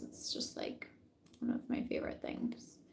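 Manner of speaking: breathy unvoiced whisper. Real, not acted. Telling the viewer a secret in a whisper. Aside while reading scent descriptions.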